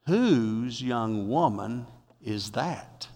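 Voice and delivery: lingering over the question, intrigued; Boaz asking. Acted. Preacher voices Boaz asking slowly.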